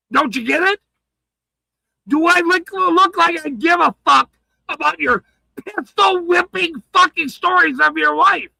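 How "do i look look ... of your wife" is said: furious shout. Real, not acted. Streamer yelling at his accusers, furious. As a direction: shout it in their faces, enraged.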